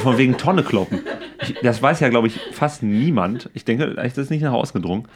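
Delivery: normal talking voice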